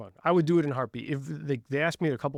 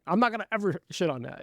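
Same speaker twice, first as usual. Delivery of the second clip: ordinary delivery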